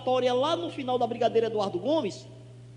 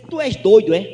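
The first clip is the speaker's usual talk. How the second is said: mock loud challenge; 15 dB louder than usual. Drunk neighbour challenges him.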